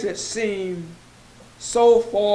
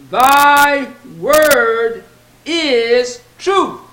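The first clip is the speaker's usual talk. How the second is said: preaching emphasis; 2 semitones higher, 6 dB louder, much slower than usual. Acted. Preacher proclaims scripture quote.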